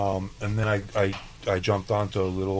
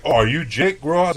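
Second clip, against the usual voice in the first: raised, louder retold greeting; higher-pitched, pleasantly animated quote; excited recognition; 8 semitones higher, 9 dB louder than usual. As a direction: act out recognizing someone by reputation. Acting out the chef recognizing him.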